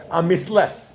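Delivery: "missless" in Eastern European Yiddish pronunciation; very Yiddish accent. Shown as a demonstration. Teacher modelling a word for class, very Yiddish accent. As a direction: say "missless" with S sounds, Yiddish style. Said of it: "pronounced with a very Yiddish accent".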